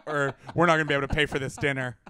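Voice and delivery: loud hammed-up panic over dinner; mock girlfriend panic. Friend acting out the girlfriend's phone buzzing, loud and hammed up. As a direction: play the frantic girlfriend, then the groaning boyfriend. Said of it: "she's like".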